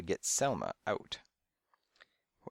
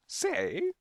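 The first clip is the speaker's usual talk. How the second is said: high parrot squawk. A narrator's reading. Parrots echo the detective's word, high mimicking squawk. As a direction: squeak it back like a parrot. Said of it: "They just parroted".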